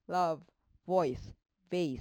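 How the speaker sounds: Love, voice with a voiced lip-bite V; English V sound. Shown as a demonstration. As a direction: teeth on lip buzzing the V in voice. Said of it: "気をつけて音読される".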